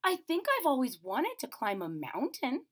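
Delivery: high, awed little bear; little bear voice full of wonder. Acted. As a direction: marvel at the huge climb. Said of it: "he wondered".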